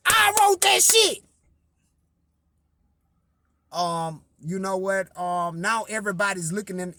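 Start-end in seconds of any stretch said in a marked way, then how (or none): 0.1-1.2 high, loud, slow indignant claim; high and loud, slowly claiming the song as yours; street side coming out. Retold or imitated. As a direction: let your street side out, drawn out.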